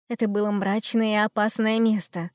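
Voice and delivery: plain reading voice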